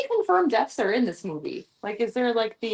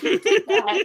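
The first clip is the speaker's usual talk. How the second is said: higher, laughing, a put-on voice; laughing accusation; 7 semitones higher, slower than usual. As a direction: tease the dog through laughter. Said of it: "It's just like".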